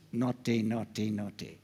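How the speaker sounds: low mock telling-off; mock scolding. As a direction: tut someone like a naughty child, low. Mimed finger-wagging scolding in pit.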